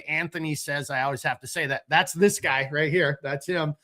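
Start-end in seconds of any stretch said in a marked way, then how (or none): none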